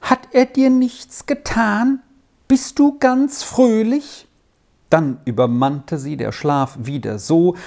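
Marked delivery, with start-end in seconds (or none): 0.0-4.3 much higher, drawn-out questions; child's questioning voice. A narrator's reading. Child asking whether he did nothing to you, high questioning. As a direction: ask it high and carefully, like a child. Child character asks about the man.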